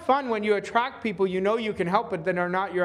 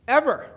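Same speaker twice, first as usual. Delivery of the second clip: higher and louder, punched alone; emphatic ever; 6 semitones higher, 5 dB louder than usual. Real, not acted. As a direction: slam the word Ever down. Coach stressing lasting trust.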